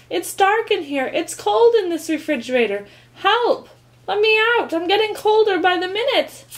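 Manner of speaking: high, loud, frightened pleading; trapped and scared. A narrator's reading. Mouse trapped in the fridge calling loudly for help. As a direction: beg to be let out.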